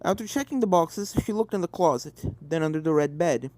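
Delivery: ordinary delivery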